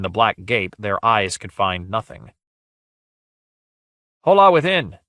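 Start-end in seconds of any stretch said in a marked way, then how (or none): 4.2-5.0 loud, high call; crying out. A narrator's reading. Guile hails whoever is within the portal, loud cry. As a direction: call boldly into the dark. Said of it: "cried Guile".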